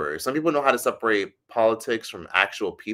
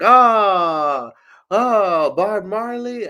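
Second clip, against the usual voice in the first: loud, very high squeal of recognition; hammed-up gushing, pitched way up; mock starstruck outburst; 11 semitones higher, 8 dB louder, much slower than usual. Mimicking people asking about Jamaica, mock excited squeal.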